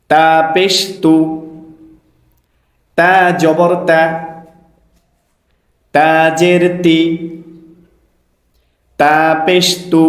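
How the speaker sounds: slow, measured teacher's chant; spelling drill recitation. Shown as a demonstration. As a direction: recite each letter deliberately, vowel drawn out. Leads a letter-spelling drill.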